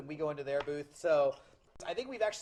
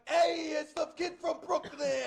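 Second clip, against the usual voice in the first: raised-pitch loudmouth, dragging out the end; Kid from Brooklyn; 5 semitones higher than usual. Retold or imitated. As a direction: imitate a hollering Brooklyn internet ranter.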